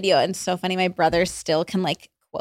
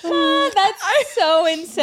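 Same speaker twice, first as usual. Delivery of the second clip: high, slow, through laughter; laughing; 9 semitones higher, much slower than usual. Real, not acted. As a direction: laugh the words out. Laughing hard.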